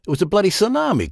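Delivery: high, fast, exasperated objection; indignant outburst. Acted. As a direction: scoff at the absurd idea, voice jumping up. Vince objects to Captain's theory.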